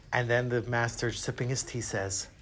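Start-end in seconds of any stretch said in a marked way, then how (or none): none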